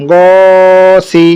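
slow, level, sustained first vowel in "গসি"; stretched reading. Shown as a demonstration. Teacher reading through for students, "গ" drawn out. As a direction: hold "গ" long in "গসি", then short "সি". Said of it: "টানা পড়ে চলে যাবো".

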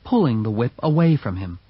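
ordinary delivery

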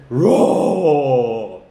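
long, falling playful roar; pretend lion roar. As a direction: roar like a lion for small children. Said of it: "pretend to be a lion".

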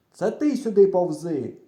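shouting back. A narrator's reading. Friend calling the boy over, stubborn shouting. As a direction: yell the order back. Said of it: "Отак, перегукуючись".

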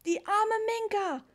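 high, loud, drawn-out wail; boy crying out. A narrator's reading. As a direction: lament loudly like a distressed child. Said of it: "rief Richie". (laut klagender Junge)

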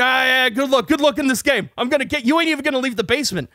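imitating a riled gamer, high pitched; mock sarcastic good-luck taunt; heated jeer, acted out; retold angry taunting.

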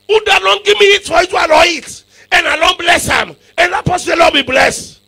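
high, loud preaching of Isaac's refusal; shouting. Acted.

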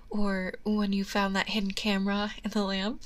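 ordinary delivery

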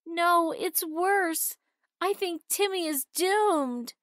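put-on girl telling her mother that her brother is doomed; crying out. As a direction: wail it like everything is lost. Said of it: "Susan cried".

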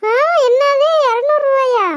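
high, loud what-two-hundred outcry; shocked at price. Acted. Mother told the bangle costs two hundred, shocked. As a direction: blurt it out in disbelief.

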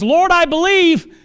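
high, loud, slow cry of belief; tearful plea. Retold or imitated. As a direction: plead it with tears in the voice. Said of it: "saying it with tears in his eyes".

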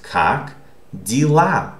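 "как дела" slowly as "kak dee-LA", stress on the last A; unstressed Е as EE. Shown as a demonstration. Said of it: "So this is pronounced".

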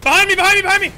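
urgent shout; calling out. Real, not acted. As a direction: yell the warning fast. Calling out enemy behind.

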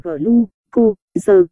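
"glu, cô, giờ" with pauses between syllables; syllable-by-syllable reading. Shown as a demonstration. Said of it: "gluco đọc là". (đọc "glu, cô, giờ" tách từng âm tiết)

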